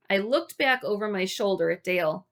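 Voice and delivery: plain reading voice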